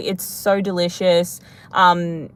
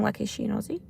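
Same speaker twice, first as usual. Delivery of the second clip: a soft whispered aside, much softer; whispered question; 11 dB quieter, faster than usual. Real, not acted. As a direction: breathe the question almost under your breath. Curious aside to viewers.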